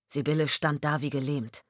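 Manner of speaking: ordinary delivery, nothing marked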